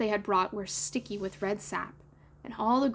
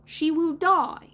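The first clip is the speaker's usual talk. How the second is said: high, loud, slow dire warning; drawn-out boyish doom, falling on DIE; Toodles; 6 semitones higher, 6 dB louder, slower than usual. A narrator's reading. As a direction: announce the awful outcome like a dramatic child. Toodles foretelling Wendy's death.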